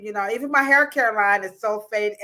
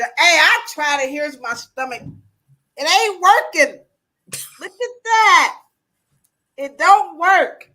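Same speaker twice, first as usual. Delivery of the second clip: loud, emphatic and pitched up; loud protest; 4 semitones higher, 7 dB louder than usual. Acted. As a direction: call it out in exasperation. Heckling the castor oil question.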